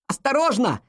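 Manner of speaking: high, sudden warning cry; sharp high-pitched alert; urgent warning. A narrator's reading. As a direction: call out a warning of danger. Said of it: "раздался его голос".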